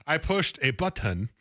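slow, over-sounded T in "button"; put-on accent. Real, not acted. Co-host teasing the host's diction, accented "button". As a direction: throw an accent on "button", hitting the T. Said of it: "you threw an accent on it".

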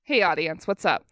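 low, close aside to listeners; conspiratorial aside. Pretend. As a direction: lean in and confide. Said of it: "this is an aside to the audience".

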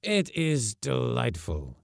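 slow and certain, in a foreign voice; slow, earnest foreign delight; with conviction. Acted. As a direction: say it slowly, as if you truly mean it. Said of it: "Villona said with conviction".

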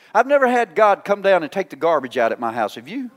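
normal talking voice

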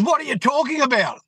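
high-pitched, affronted question; imitated indignation. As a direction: act out her baffled, annoyed demand. Retold quarrel, woman demands explanation.

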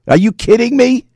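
high-pitched disbelieving question; incredulous. Real, not acted. Host reacting to the report, incredulous.

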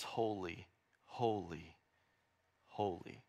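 soft, low, spaced-out holies; quiet, low-pitched reverent refrain; hushed awe. Acted. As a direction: let each word fall softly, in reverence. Angels' awed response to God.

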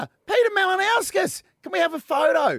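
high, eager fan asking for a photo. Retold or imitated. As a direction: lightly play a starstruck fan. Imitating fans asking for photos.

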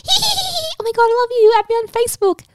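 very high, loud mock squeal; imitated drunken gushing. Drunk girls bonding in the bathroom line, gushing squeal. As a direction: squeal it like an overexcited new best friend. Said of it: "everyone was going".

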